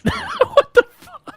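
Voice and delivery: high squeal of disbelief; shocked laugh. Real, not acted. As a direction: laugh out a startled what.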